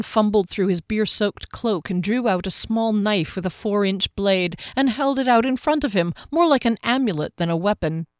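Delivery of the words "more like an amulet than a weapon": usual speaking voice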